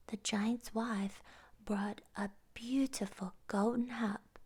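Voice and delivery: ordinary delivery, nothing marked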